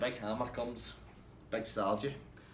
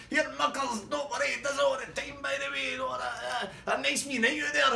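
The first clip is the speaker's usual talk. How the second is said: much higher cheerful shouting; Swally shouting his cheery goodbye at the fleeing couple; mock cheery shout; 13 semitones higher, 4 dB louder than usual. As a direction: holler it after them like a send-off. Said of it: "Swally shouting".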